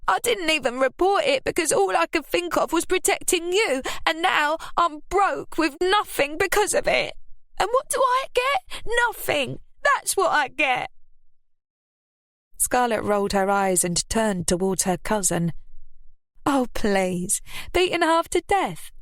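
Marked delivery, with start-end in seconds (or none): 0.1-10.9 high, rising self-pitying rant; losing her temper. A narrator's reading. Ruby ranting at Lily that nobody believes her. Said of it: "beginning to lose her temper". 16.4-18.9 mocking repeat. A narrator's reading. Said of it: "she repeated".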